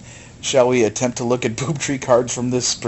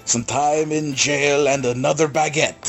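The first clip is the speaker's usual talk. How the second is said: slow, hammed-up French accent; bad French accent; 2 semitones higher, slower than usual. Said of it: "Bad French Accents".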